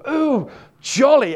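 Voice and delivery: slow, high mock English king; Henry the eighth. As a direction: ham up a gleeful English royal. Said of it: "Henry the eighth ... says".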